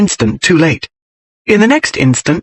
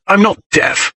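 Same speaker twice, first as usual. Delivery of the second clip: cross; 6 semitones higher than usual. A narrator's reading. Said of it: "came Dane's cross voice".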